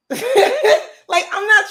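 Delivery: a burst of laughter on the word; laughing. Real, not acted. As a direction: laugh it out high and loud. Laughs mid-rant on livestream.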